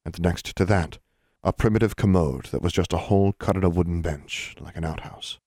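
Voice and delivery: ordinary delivery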